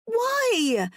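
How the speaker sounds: very high ringing cry; drawn-out, appalled outcry. A narrator's reading. Woman demanding his reason across the ice, crying out. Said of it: "my voice rings out".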